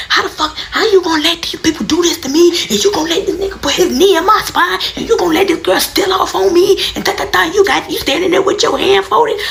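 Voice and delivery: high, shouted tirade at her mother; furious yelling. Retold or imitated. Screaming at mom for letting them hurt her, furious. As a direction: yell it at her, outraged and betrayed. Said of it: "I'm like".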